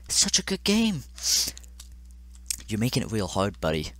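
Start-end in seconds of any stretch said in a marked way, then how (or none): 0.1-1.0 high-pitched, mock-sweet praise; forced flattery. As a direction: flatter the game through the strain.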